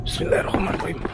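whispered nervous prayer; scared whispering. Real, not acted. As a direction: breathe the prayer out in fear. Praying before entering a creepy building.

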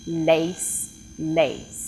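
lace then lace, both with a clear L; same-word demo. Shown as a demonstration. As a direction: lace said twice alike for a listening test. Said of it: "I'll say some words".

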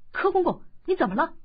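loud, high fake-startled call; a boy faking alarm, calling out loud; feigned alarmed shout. A narrator's reading. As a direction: cry out in pretend shock. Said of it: "当下假装吃惊，大叫".

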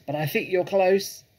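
high, loud call across; shouted. A narrator's reading. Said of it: "shouted Boggis".

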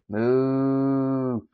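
long, drawn-out moo; playful cow moo. Pretend. Player greets a cow in the game, playful mooing.

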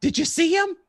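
high, loud astonished question; excited retold question. Acting out excited man's question.

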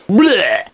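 loud, high gagging blech; disgusted blech. Real, not acted. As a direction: spit out a grossed-out blech. Disgust at own inappropriateness.

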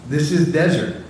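a touch of incredulous doubt on is; mild scoffing, as if it makes no sense; doubtful reaction. Retold or imitated. Preacher voicing our doubting reaction, lightly scoffing. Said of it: "we feed it back, like".

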